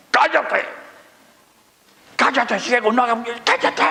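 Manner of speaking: hushing scolding. Acted. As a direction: scold him into silence, voice pitched high. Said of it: "Muchos lo mandaban a callar".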